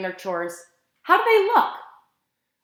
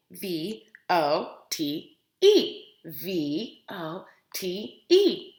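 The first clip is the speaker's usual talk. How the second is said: slow, fading letter by letter; spelled out slowly; 5 semitones lower, 4 dB quieter, much slower than usual. Shown as a demonstration. Teacher spelling the word twice for the children. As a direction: spell it twice, quieter and slower.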